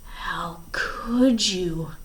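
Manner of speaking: slow, hoarse whisper. Acted. Klaus confronts Stefano in a slow hoarse whisper. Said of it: "Klaus whispered hoarsely".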